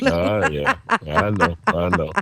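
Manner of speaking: sheepish laughing admission. Real, not acted. Caught talking over his wife, laughing. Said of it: "You're interrupting".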